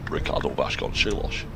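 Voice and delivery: low mock Sean Connery accent; bad Sean Connery accent. Shown as a demonstration. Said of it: "without putting on a bad Sean Connery accent".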